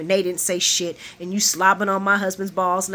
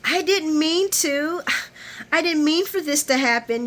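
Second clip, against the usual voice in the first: mock pleading; 7 semitones higher than usual. Imitating Nina excusing herself to Carly, mock pleading. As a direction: plead helplessly, hammed-up.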